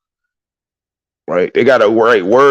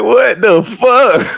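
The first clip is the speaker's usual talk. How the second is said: amazed; 13 semitones higher, much slower than usual. Retold or imitated. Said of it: "I'm like".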